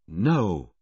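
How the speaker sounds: ordinary delivery, nothing marked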